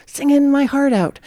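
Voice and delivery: high-pitched lament at oneself; self-reproaching wail, played lightly; mock regret. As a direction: kick yourself over a missed chance. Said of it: "one of my deep regrets".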